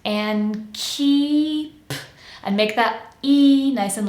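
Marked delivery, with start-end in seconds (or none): 0.1-1.9 "and keep" held high and stretched, d dropped, p released. Shown as a demonstration. Coach models the transition, slow stretched demo. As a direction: draw out "and keep" as "an keep". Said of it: "transition quickly to and k".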